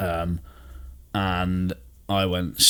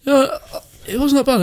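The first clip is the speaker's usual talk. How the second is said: much higher, louder mock voice; imitating Sean; 6 dB louder than usual. Quoting listener Sean's backhanded praise, high mock voice. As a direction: mimic the grudging critic. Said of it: "he said".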